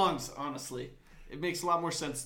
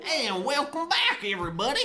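put-on country accent; 8 semitones higher than usual. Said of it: "them country accents".

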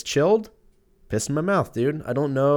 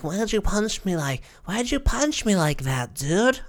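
put-on pitched way up, whining like a hurt little boy; high, wounded kid asking why; high whiny kid voice; 9 semitones higher than usual. Scrawny kid asking the puncher why, high and whiny. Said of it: "That's probably what I sounded like".

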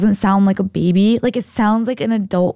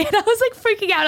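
laughing; 12 semitones higher than usual. Real, not acted. Laughing while telling the scare.

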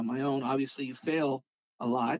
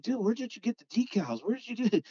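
higher-pitched curious pestering; retold eager question; 7 semitones higher than usual. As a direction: imitate an impressed fan asking. Eager questions to admired customizer.

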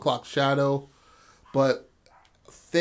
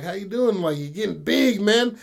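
hearty dad; 7 semitones higher than usual. Retold or imitated. Dad greeting son warmly.

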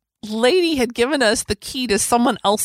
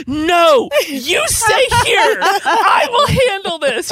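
protective husband; 8 semitones higher, much slower than usual. Retold or imitated. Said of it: "And he's like".

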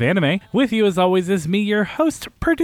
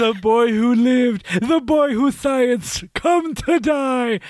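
dark wizard voice; 6 semitones higher, slower than usual. Acted. Voldemort joke just made.

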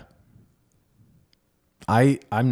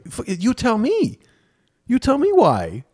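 exasperated retort; 6 dB louder than usual. Retold or imitated. To therapist asking why, high exasperated retort. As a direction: throw the question back at her.

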